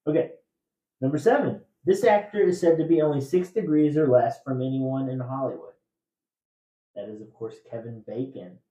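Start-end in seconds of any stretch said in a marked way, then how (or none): 1.0-5.7 loud, measured trivia question read; quiz host reading. Acted. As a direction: read the clue out to the room.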